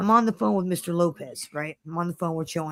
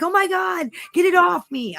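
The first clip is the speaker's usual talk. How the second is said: mock panic; 10 semitones higher than usual. Imagined leech panic, acted.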